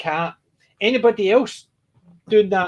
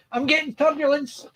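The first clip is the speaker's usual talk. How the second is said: mock pilot; 10 semitones higher than usual. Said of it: "he's going".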